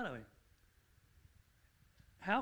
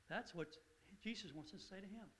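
ordinary talk

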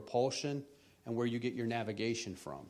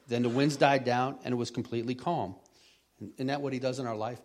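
ordinary delivery

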